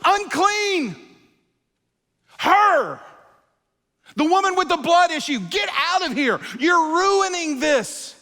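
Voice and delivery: high, loud shouts of the crowd; angry crowd yelling. Retold or imitated. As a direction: shout her out of the way. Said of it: "hearing people go".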